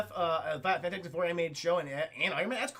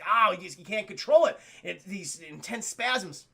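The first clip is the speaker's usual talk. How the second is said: ordinary talk, nothing marked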